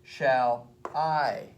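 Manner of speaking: "Shall I" drawn out, weight on "I"; slow "Shall", then a long stressed "I". Shown as a demonstration. Teacher repeats the iamb example, stress on "I". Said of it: "It's an I am".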